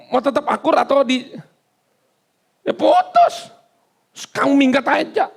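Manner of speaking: squeaky high "ya putus", a sudden falsetto jump; mock wife's ultimatum. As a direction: tease it like a sulky wife in falsetto.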